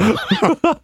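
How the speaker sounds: high, loud peal of laughter; real high-pitched cackling laugh. Real, not acted. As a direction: let out another high burst of laughing. Laughs on after the joke.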